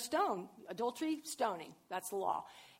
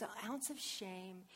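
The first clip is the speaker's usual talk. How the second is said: usual speaking voice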